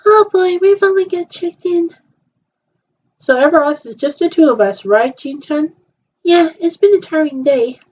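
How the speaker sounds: high, bright-eyed play character; a doll chatting after check-in; girly doll voice. Pretend. Voicing toy girls at hotel.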